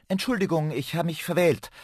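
high-pitched, flustered little apology; hasty apology. A narrator's reading. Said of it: "sagte er hastig".

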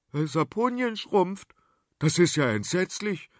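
blurted dismay. Acted. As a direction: let it slip out in shock. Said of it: "entfuhr es Prinz Wendelin".

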